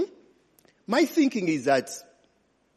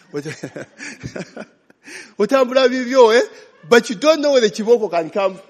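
laughing; 6 semitones higher, 4 dB louder, faster than usual. Real, not acted. Laughing while preaching.

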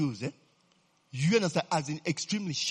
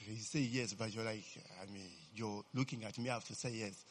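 quiet teasing aside; 8 semitones lower, 14 dB quieter than usual. Real, not acted.

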